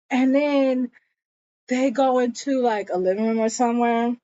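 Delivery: ordinary talk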